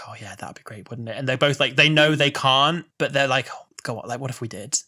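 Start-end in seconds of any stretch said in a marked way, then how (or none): 0.1-1.2 very soft, near-whispered quote; hushed retold reply. Voicing a character's wistful reply. 3.5-4.8 softly voiced quote; hushed retold wish. Voicing both characters' wishful exchange.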